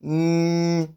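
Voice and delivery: long, level hummed "Mm" through the nose; NY nasal sound. Shown as a demonstration. Teacher demonstrating the NY digraph, held nasal hum. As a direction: hold "Mm" steady with the mouth passage blocked. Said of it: "that's the sound it produces".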